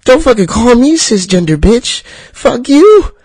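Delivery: high, loud shouted comeback; pretend shout back. Pushing back at someone who calls him cisgender, shouting. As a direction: yell it back like you've been insulted. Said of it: "should be like".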